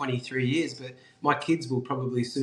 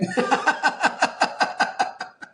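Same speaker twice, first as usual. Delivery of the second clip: laugh; 13 semitones higher, 4 dB louder than usual. Real, not acted. Laughing about reading two books.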